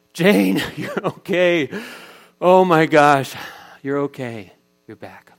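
high, loud, laughing relief, slow; relieved cry. Retold or imitated. Father hugging his found daughter, loud tearful relief. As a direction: gasp it out with overwhelming relief.

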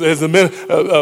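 ordinary delivery

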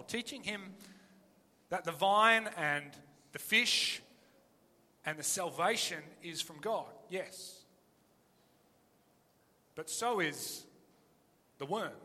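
normal talking voice

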